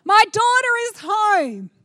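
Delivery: an overjoyed mother greeting her daughter; excited shout. Pretend. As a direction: yell it so the whole airport hears. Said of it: "it'll be like".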